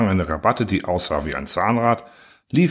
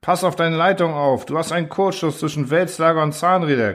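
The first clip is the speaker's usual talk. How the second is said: angry orders; 8 semitones higher than usual. A narrator's reading. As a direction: drive him to act now. Engineer barking orders.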